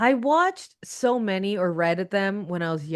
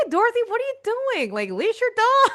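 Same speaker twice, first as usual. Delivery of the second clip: high-pitched, laughing telling-off; exasperated mock reprimand, pitched way up and breaking into laughter; mock scolding; 13 semitones higher, 3 dB louder than usual. Podcaster telling Dorothy off about her dog, playful high-pitched scolding.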